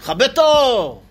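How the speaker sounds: the crowd yelling at him to turn it off; shouted. Retold or imitated. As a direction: audience shouting at the villager. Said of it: "כולם צועקים עליו".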